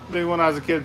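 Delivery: ordinary delivery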